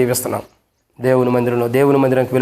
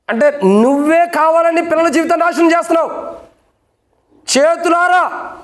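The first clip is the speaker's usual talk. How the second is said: high, impassioned pulpit rebuke; preaching at full force; 9 semitones higher than usual. Acted. Pastor rebuking parents who won't discipline, impassioned preaching. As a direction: thunder it at the congregation like a preacher.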